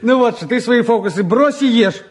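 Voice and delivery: ordinary delivery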